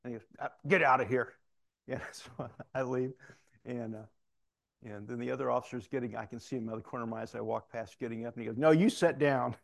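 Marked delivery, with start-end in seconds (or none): 0.4-1.3 loud, exasperated mock order; captain's loud send-off, acted out; imitated dismissal. 8.6-9.5 loud, firm mock command; captain putting his foot down, acted out; imitated stern order. Captain stops the officer leaving.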